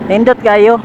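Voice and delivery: ordinary talk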